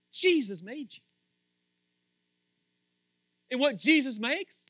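high, loud rising sermon build; loud preaching climb; preaching at full force. Real, not acted. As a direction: lift the room with every line.

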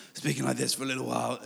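a touch of a fake older man; weird put-on voice. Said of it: "did this weird voice".

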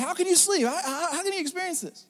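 high, soft, put-on sailor voice; frantic sailors. Sailors to sleeping Jonah, high incredulous pleading. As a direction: play the sailors pleading in disbelief. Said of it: "They're like".

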